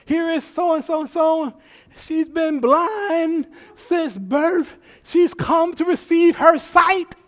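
high, slow announcing preacher. Retold or imitated. Pastor presenting the blind woman, grand. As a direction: present her grandly to the crowd.

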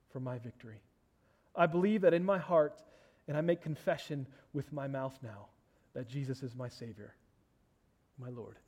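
solemn spoken prayer. Acted. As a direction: lead them through it word by word. Leads a prayer.

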